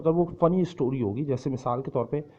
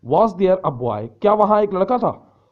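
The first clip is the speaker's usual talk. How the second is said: loud quizzing question to the class, rising high on the Hindi; teacher's loud question; 5 semitones higher, 7 dB louder than usual. Shown as a demonstration. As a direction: fire the question loudly at the students. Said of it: "आपसे कह रहा हूँ". (छात्रों से ज़ोर से पूछा गया सवाल)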